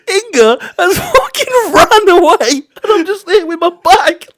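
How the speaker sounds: breaking into high laughter mid-sentence; can barely get the words out for laughing; laughing hard. Real, not acted. Telling a friend about the boat panic, laughing hard.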